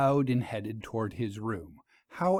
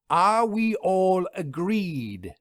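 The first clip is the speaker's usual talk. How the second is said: slow, stern scolding, pitch raised; 6 semitones higher, 3 dB louder, much slower than usual. A narrator's reading. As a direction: drag out each word to rebuke them. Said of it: "Vera scolded".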